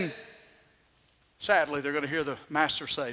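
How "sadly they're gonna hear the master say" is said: ordinary talk, nothing marked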